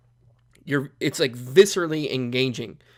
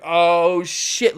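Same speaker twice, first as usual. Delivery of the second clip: excited exclamation; 3 semitones higher, 6 dB louder than usual. Retold or imitated. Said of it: "and you're like".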